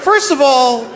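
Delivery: high-pitched, offended protest; indignant objection. Retold or imitated. Comedian objecting to president's slur, indignant. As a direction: start the objection high and affronted.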